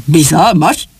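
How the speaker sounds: questioning, rising suspicion; suspicious recoil. Acted. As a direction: echo it back warily as a question. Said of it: "gyanakodva hátrahőkölt". (gyanakvó, visszakérdező hangon)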